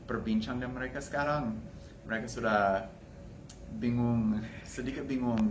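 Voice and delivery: ordinary delivery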